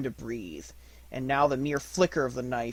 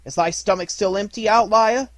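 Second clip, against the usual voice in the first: taunt; 8 semitones higher, 10 dB louder than usual. A narrator's reading. Mowgli taunts the wolf.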